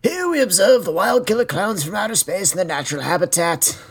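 nature documentary. Pretend. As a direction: narrate it like a wildlife film. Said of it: "we go full, like, Planet Earth".

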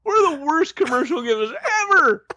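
high laughing voice. Real, not acted. Laughing falsetto.

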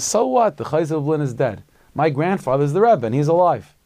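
put-on loud, high, cocky kid's shout; a brash boy shouting down an old man; defiant retort. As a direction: fire back at him without flinching. Boy's defiant retort to elder.

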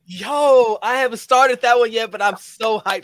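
real excitement over a new anime; excited. Real, not acted. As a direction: burst out thrilled about the show.

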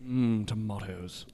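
very slow, low lingering murmur; slow sultry murmur. Pretend. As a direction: draw it out seductively. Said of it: "that is almost spot on".

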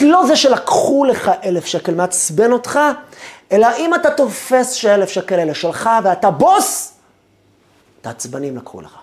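ordinary talk, nothing marked

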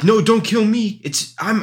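high, urgent mock plea; hammed-up panicked insistence; pleading clone. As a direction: beg for your life, half-playfully. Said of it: "and they're like".

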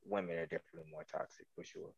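very low, very soft mumbled answer; barely audible. Real, not acted. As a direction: answer so softly the room can't hear. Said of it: "We couldn't hear you".

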